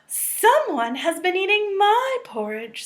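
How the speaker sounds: lightly voiced startled mother bear; Mama Bear voice.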